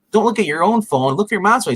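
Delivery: high-pitched imitated scolding; mock exasperated. Retold girl scolding Max.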